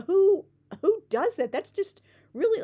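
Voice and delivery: high, loud, appalled; disgusted outburst. Real, not acted. Ranting about people covered in stains, loud and disgusted. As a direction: burst out in disgust.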